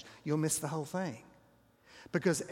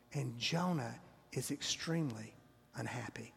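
whisper; 4 semitones lower, 7 dB quieter than usual. Real, not acted. Preacher whispers narration.

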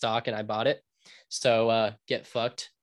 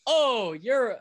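mock incredulous; 8 semitones higher, slower than usual. Mock questioning before the snip.

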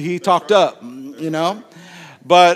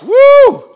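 high, loud "woo"; sudden high-pitched acted whoop; excited whoop; 12 dB louder than usual. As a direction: whoop in delighted amazement. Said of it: "Jesus was like".

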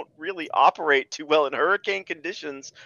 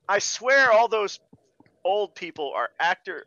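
ordinary delivery

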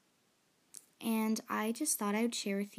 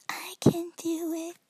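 eager whispered volunteering; 8 semitones higher, 6 dB louder than usual. Retold or imitated. Nervous self, raising hand.

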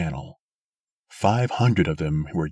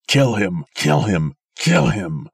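loud croaking KILL him, less frenzied; croaked; 4 semitones higher, 6 dB louder than usual. A narrator's reading. Failing old man croaking at the crowd to kill Sim. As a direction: croak out the demand. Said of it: "croaked the old man".

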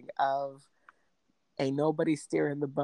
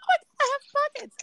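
much higher, fast, squeaky delight; ditzy surprise; 5 dB louder, much faster than usual. Retold or imitated. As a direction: gasp it out like you just found treasure. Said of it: "And I'm over here like".

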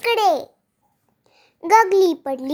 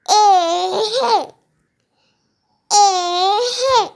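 slow stretched heaving with a laugh; straining to pull; 3 semitones higher, much slower than usual. A narrator's reading. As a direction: drag out each heave, half laughing. Three pulling the radish together. (जोर लावत हसत कुंथणे)

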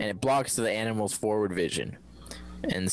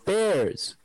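incredulous; 8 semitones higher than usual. Acted. Incredulous squeal about bears.

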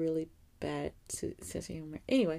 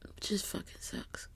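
slow unvoiced whisper; tearful whisper; 8 dB quieter than usual. Real, not acted. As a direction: drag it out in a whisper.

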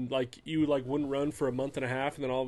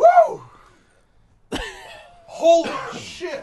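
shocked yelp after a sharp whiff; hit with smelling salts; 8 dB louder, much slower than usual. Real, not acted. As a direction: reel back yelling from the sting. Just sniffed smelling salts.

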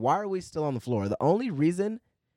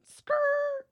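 high squealing skid noise, as if brakes lock; a quick, sharp mock tire squeal; tire screech sound; 3 dB louder than usual. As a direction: mimic car tires screeching to a stop. Cops' cars screeching around corner.

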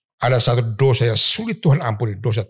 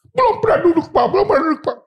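husband crying; 4 dB louder, faster than usual. Retold or imitated.

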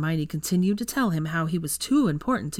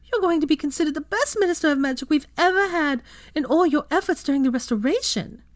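much higher, louder gushing falsetto; grinning mock flattery; 11 semitones higher, 4 dB louder than usual. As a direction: overdo the praise, hammed-up. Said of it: "Theo started with a grin".

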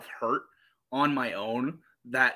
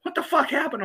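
high-pitched startled demand; alarmed mom; 13 semitones higher, 4 dB louder than usual. Retold or imitated. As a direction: imitate a mom in sudden alarm. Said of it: "sat bolt upright and went".

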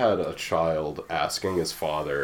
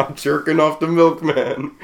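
laughing; 10 semitones higher, 7 dB louder than usual. Real, not acted. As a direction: repeat it high, laughing hard. Repeats it laughing.

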